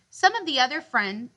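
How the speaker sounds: plain reading voice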